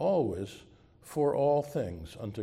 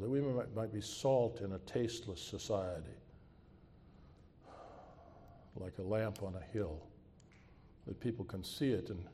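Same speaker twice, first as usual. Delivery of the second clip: soft, earnest closing prayer; 4 dB quieter than usual. Acted. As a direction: pray quietly and sincerely.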